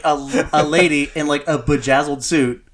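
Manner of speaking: usual speaking voice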